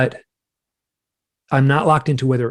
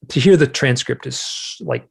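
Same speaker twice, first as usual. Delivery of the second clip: ordinary talk, nothing marked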